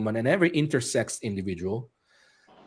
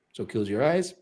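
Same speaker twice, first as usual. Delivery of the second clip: guided meditation; 3 dB quieter than usual. Acted. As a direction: ease them into breathing. Leads breathing meditation.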